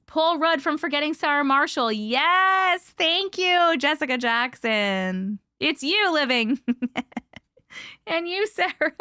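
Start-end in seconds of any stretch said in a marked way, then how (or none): none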